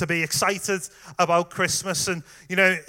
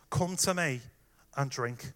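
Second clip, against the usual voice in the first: softer, gentle and tender; soft invitation; 4 semitones lower, 8 dB quieter than usual. Acted. Preacher inviting tired listeners to come, soft and tender. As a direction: offer it quietly like a comfort.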